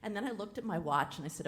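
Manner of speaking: usual speaking voice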